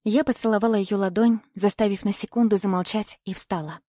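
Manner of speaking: ordinary delivery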